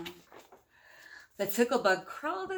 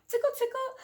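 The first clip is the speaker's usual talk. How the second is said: playful bug voice; 4 dB louder, faster than usual. Acted. Bug teasing the picture.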